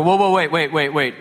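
mock alarm. Homeowner stopping the pod cutting, mildly alarmed. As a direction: put the brakes on in acted alarm. Acted halting of the saw plan.

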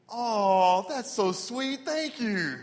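high, cooing brush-off; hammed-up sweet thanks; awkward fake gratitude. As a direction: coo it politely to dodge the declaration. Acts awkward reply to love confession.

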